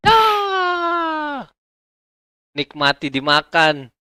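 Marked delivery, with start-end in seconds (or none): 0.1-1.5 very high, loud shriek; hammed-up scream. Phoenix mauled by the tiger, a mock scream. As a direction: scream as the claws come down.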